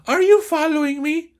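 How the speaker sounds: very high female voice; corrected high falsetto Raquel; Raquel's voice. Acted. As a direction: push the pitch up to sound like a woman. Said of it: "ese Raquel es mujer".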